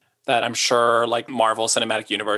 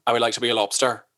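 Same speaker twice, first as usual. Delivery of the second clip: put-on Irish accent; faster than usual. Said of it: "I think I can do Irish".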